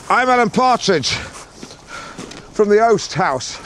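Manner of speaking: slow, high, drawn-out announcement of his name; grand self-announcement. Acted. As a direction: proclaim yourself like a herald, slowly. Announcing himself at the door.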